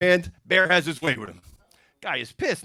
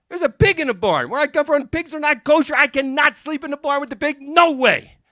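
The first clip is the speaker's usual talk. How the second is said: higher-pitched, worked-up protest; acted indignant refusal; 7 semitones higher, 3 dB louder than usual. Guest refuses to sleep with the pig, indignant. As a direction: object firmly, pitch held high to the end.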